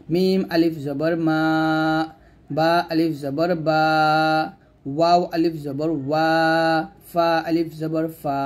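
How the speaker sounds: slow drill, long vowels on মা, বা, ওয়া, ফা; drawn-out madd. Shown as a demonstration. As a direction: stretch each last syllable: মা, বা, ওয়া, ফা. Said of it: "মাদে আমরা টান দিব".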